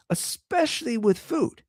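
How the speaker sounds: mock earnest. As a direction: sell the claim with a wink. Comic emphasis on openness.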